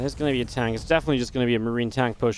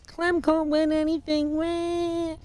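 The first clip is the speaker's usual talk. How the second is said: high, drawn-out mock whine; mocking whiny hater; 14 semitones higher, much slower than usual. Caster mimicking haters in YouTube comments, mocking high whine. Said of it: "I've seen them in the YouTube comments".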